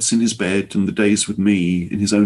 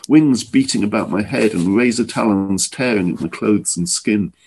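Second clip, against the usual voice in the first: ordinary delivery, nothing marked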